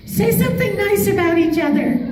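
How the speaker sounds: put-on high sweet girl asking a favor; sweet fan girl.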